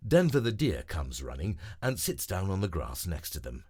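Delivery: usual speaking voice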